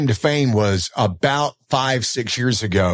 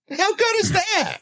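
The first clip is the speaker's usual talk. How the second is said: delighted marvelling. Retold or imitated. Football fan retelling that both teams won, delighted. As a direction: let the pitch soar in happy amazement.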